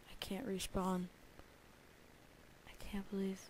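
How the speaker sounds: whispered disbelief; stunned whisper. Real, not acted. Gamer whispering after losing his hardcore world. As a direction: breathe it out, shocked.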